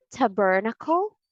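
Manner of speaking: tabernacle as tuh-BER-nuh-kul, rising; wrong stress reading. Shown as a demonstration. Teacher offers possible readings, wrong stress. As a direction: ask tabernacle with a weak first vowel. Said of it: "How do you read this?".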